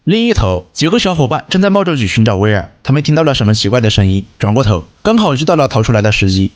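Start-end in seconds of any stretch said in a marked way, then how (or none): none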